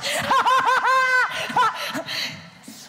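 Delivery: big burst of high laughter; loud high laugh. Real, not acted. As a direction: crack up loudly. (éclat de rire aigu et fort)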